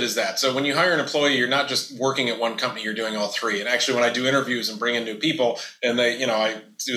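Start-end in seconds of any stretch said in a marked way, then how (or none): none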